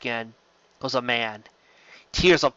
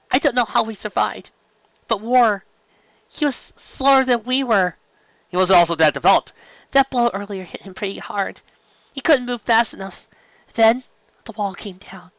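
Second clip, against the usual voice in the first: higher-pitched voice for the officer; a shaken officer, higher voice; Connolly's voice; 8 semitones higher than usual. Acted.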